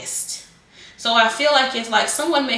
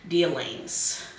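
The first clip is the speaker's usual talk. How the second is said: ordinary delivery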